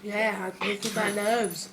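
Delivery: ordinary delivery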